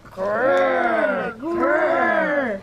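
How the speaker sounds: loud, high choral bear growl. Pretend. Pupils reading the bear's part in unison, loud growl. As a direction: growl out loud like little bears.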